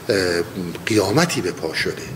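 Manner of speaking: ordinary delivery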